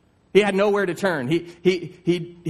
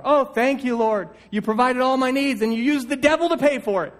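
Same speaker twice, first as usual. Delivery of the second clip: higher, bright rush of thanks; excited praise; 7 semitones higher than usual. Acted. Mom gleefully thanking God after the atheist's groceries, excited. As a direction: burst out thanking the Lord.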